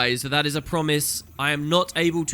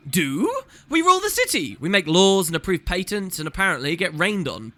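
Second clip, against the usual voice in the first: affronted councillor. Acted. Councillor telling a visitor what the council does, affronted. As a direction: proclaim it with hammed-up self-importance.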